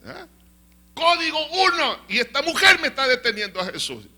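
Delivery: high, loud emergency call; urgent outcry. Acted. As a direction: cry out urgently as the held-up father. Acts out the frantic emergency father. (grito urgente, alto y fuerte)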